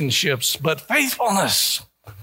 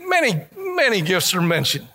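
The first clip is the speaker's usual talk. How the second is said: ordinary delivery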